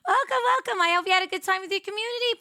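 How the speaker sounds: high, fast excited greeting; high bubbly hello to raiders; excited welcome. Real, not acted. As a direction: gush the welcome.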